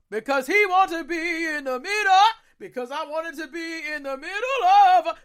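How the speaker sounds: high, stretched-out mock wail; comedy catchphrase impression. Podcast fan riffing on choosing the middle, high exaggerated wail. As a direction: draw it out high, hammed up. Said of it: "If you know Comedy Bang Bang, you know the reference".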